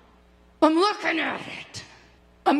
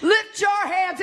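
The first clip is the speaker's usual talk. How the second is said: ordinary talk, nothing marked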